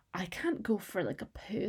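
hushed, breathless whisper of panic; embarrassed whisper. Retold or imitated. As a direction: whisper it as if the boys might hear. Retelling panic about school-trip toilets.

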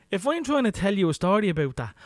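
ordinary talk, nothing marked

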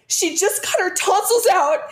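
high-pitched, distressed retort, re-enacted; upset outburst. Upset mom turning on the judgy barista, high and distraught. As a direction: blurt it high, defending your child. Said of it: "so upset and so worried for her".

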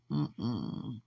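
usual speaking voice

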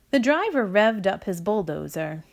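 ordinary delivery, nothing marked

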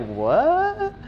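very high, thin rising "what"; mock confusion, pitch shooting up; bewildered. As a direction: squeak out a puzzled question. Acts bewilderment at the warnings.

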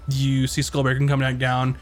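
ordinary delivery

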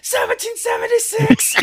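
slow, high-pitched mock battle cry; yell. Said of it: "and then just yell out".

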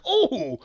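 high, drawn-out reaction cry; reenacted wincing "oh". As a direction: wince aloud at someone's hard fall. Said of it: "I was like".

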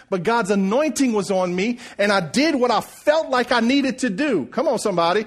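high, impassioned sermon climax; full-force preaching. Acted. Preacher telling church he was ministered to, full-force preaching. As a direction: build it up and proclaim it.

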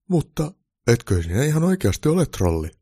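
ordinary delivery

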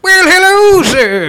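high, booming clown hello; clown greeting. Acted. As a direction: belt it out like an over-the-top clown.

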